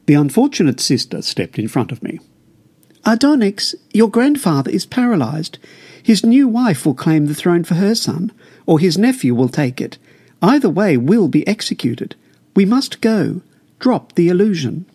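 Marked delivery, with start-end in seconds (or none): 3.0-14.8 mother's voice, higher, urging her son; anxious mother pleading with her son; urgent mother. Acted. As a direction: press him to leave now, higher pitch. Mother urges her son to flee.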